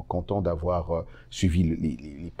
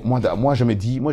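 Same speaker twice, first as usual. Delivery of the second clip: ordinary talk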